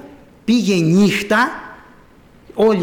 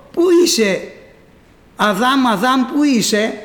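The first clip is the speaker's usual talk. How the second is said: high, loud searching call; 5 semitones higher, 4 dB louder than usual. Acted. God calling Adam from hiding. (φωνάζει δυνατά ψάχνοντας)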